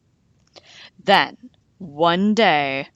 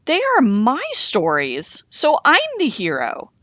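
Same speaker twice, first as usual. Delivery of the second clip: 1 insisting; 7 semitones higher, 4 dB louder than usual. A narrator's reading. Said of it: "he insisted".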